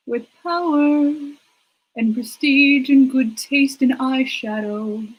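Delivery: slow, grand regal declaration; grandiose. Pretend. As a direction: proclaim it like a queen. Grand mock royal pronouncement.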